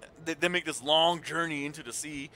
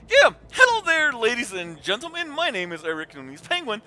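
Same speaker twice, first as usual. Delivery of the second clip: showman intro; 6 semitones higher, 8 dB louder than usual. Retold or imitated. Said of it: "for my intro".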